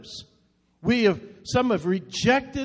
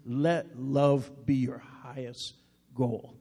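slow verse reading; 6 semitones lower, 5 dB quieter, much slower than usual. Shown as a demonstration. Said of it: "First Corinthians fourteen one says".

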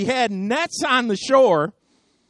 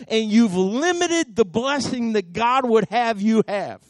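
ordinary talk

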